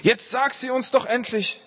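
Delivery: high-pitched urgent plea; impatient demand. A narrator's reading. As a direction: demand it, unable to wait. Men lose patience with the computer.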